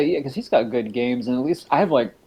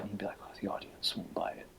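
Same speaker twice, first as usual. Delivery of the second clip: unvoiced whisper, very soft; hushed aside; 5 semitones lower, 19 dB quieter, faster than usual. Voicing Donkey Kong in bit.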